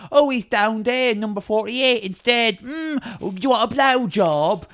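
high, slow camp reply; hammed-up high camp man. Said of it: "It's like".

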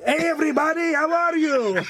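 high, squeezed puppet voice; Chihuahua puppet. Pretend. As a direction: greet the crowd as a tiny dog puppet. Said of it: "I've got a Chihuahua puppet".

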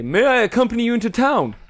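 high, theatrical polite request; odd character voice. Acted. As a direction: ask high in the oddball hero's voice. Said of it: "he's gonna say".

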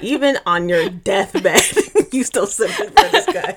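teasing, laughing at the end; amused mock scolding. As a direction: scold her jokingly through a laugh. Mocking Bella on her deathbed.

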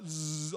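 soft buzzing zzz; quiet, droning sci-fi pull; tractor beam hum. Retold or imitated. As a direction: imitate a tractor beam's low buzz. Imitates a tractor beam pulling.

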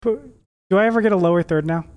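ordinary delivery

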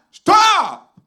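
high, loud cry of alarm; a startled scream of "Stop!"; retold shout. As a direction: yell it out as the vision hits. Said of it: "And I had".

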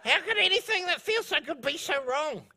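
high, put-on voice of people protesting; imitated objector. Preacher mimicking people defending what feels good, mock pleading. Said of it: "that's what people say".

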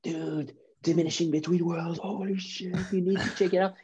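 mock hyped fan gushing; excited fan quote. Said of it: "People were talking about it".

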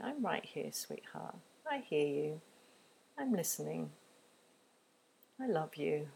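soft, near-whispered soothing; tender comfort. Shown as a demonstration. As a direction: comfort a crying child quietly. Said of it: "keeping offering empathy".

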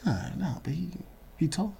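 hushed whisper into the mic. Real, not acted. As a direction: whisper it so he can't hear. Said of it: "whisper into the mic".

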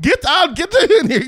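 high, loud, laughing command; mock yell pulling the kid out; hammed-up parent shout. Acting out dad and mom pulling.

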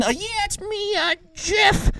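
very high, squeaky phone-in caller; odd caller voice. Acted. Caller Jeff phoning a telethon, odd high-pitched voice. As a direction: put on a ridiculous falsetto for the caller. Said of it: "I don't know why I talk like this".

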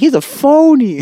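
squeaky high tattling voice; high mocking lady voice. Sample lady snitching, high squeaky mock voice. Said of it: "She's like".

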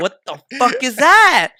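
high, loud and slow in disbelief; retelling his confusion, loud and high; baffled shout. Retold or imitated. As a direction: stop everything and blurt it out. Shocked at the strange word.

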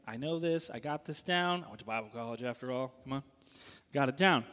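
low, hushed self-satisfied muttering; smug inner thought. Retold or imitated. Young Bible grad telling himself he knows it all, hushed and smug. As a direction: quietly reassure yourself you've got it.

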